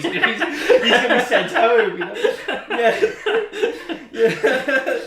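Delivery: can't hold back the laugh. Real, not acted. Laughing while quoting.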